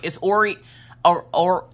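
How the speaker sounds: stumbling, restarting on the first syllable; tripping over name. Retold or imitated. Mom flustered trying to correct the staff, tripping over it. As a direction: keep getting stuck on "or". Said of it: "I kept messing up trying to say it".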